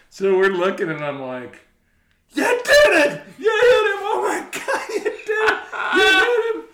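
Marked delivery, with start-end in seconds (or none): 2.3-6.6 high, loud mock-horrified yelling through laughter; shrieked reaction. Golfer to his friend whose ball hit someone, shrieking. Acting out their shocked reaction.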